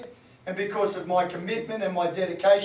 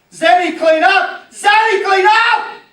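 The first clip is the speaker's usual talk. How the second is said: much higher, loud shout up the stairs; shouting parent, slow and much louder; yelling parent; 11 semitones higher, 15 dB louder, slower than usual. Retold or imitated. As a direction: yell at the kid to tidy her room.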